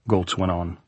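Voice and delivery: ordinary talk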